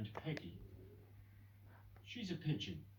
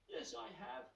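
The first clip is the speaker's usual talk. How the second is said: sniffed; 9 semitones higher than usual. Acted. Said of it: "sniffed Peggy".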